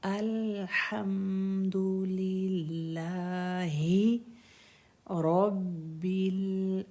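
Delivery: slow, chanted Quranic reading with each letter clear; slow tajweed recitation. Shown as a demonstration. Teacher chanting the verse slowly for her students. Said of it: "ये हा से".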